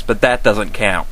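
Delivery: normal talking voice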